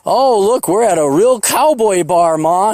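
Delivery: hammed-up sightseer wonder; mock tourist. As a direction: imitate a wowed tourist calling to Ma. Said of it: "all the tourists come in".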